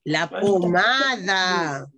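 la pomada stretched out slowly; slow dictation. Shown as a demonstration. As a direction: say it slowly as the children write. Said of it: "teacher dictating to children".

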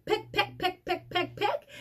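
high, soft chanted peck peck; pecking sounds. Shown as a demonstration. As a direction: tap it out lightly like a chick's beak. Mimics chick pecking egg.

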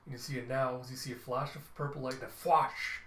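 usual speaking voice

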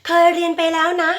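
high, level protest in a pupil's voice; puzzled pupil. Acted. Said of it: "บางคนงง".

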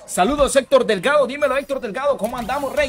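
high, excited greeting; upbeat shout-out. Real, not acted. Streamer greets Héctor on the stream, high excited shout-out. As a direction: hail the viewer with high energy.

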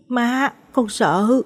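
put-on drawn-out, fearful child's voice; scared stammer. A narrator's reading. Small boy answers his father's question, scared and halting. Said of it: "Mít lắp bắp".

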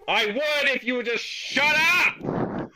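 loud, slow, exasperated yell; fed-up shout. Acted. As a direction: bellow it out, completely out of patience. Exasperated sleeper yells at mosquitoes.

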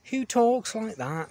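high, loud, slow disbelief; incredulous. Real, not acted. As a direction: ask it as if nobody sane would talk so. Incredulous rant at TV writing.

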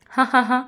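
short acted "ha-ha-ha" laugh; mock laugh. Groom laughing as she agrees to marry, mock laugh. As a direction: laugh it out in separate ha's. Villain character laughs at her consent.

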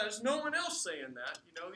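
usual speaking voice

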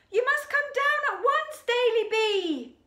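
high, loud alarmed cry; panicked shout. Acted. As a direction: yell up the tree in panic.

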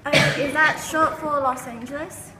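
loud put-on British accent; questioning, in a fake British accent. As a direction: ask like a pretend Brit. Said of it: "She's from Britain".

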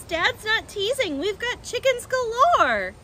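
high, slow, crowing cub voices; excited small foxes. A narrator's reading. Small foxes telling Badger about chickens, high and excited. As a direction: crow proudly like little cubs. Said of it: "cried the small foxes".

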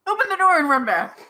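loud, high burst of urgency; hurried dash. Pretend. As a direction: blurt it at full volume as if darting away. Acting a panicked dash to door.

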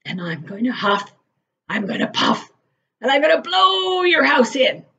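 wolf warning the pigs, swelling; wolf threat. A narrator's reading. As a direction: push it out as the menacing wolf.

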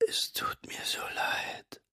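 slow, voiceless dying whisper; father's last apology; faint whisper. A narrator's reading. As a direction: barely get it out. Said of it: "Seine Stimme war kaum noch zu hören".

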